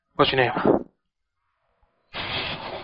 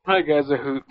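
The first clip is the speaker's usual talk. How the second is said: ordinary talk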